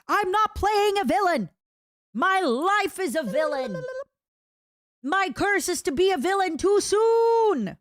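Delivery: dramatic villain rant. Acted. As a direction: wail it like a stage villain.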